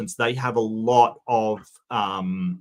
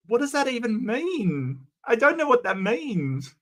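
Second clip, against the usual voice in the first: high-pitched, real exasperation; exasperated bafflement; 11 semitones higher than usual. Real, not acted. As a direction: protest in bafflement, voice climbing high. Baffled by idea of normal talk.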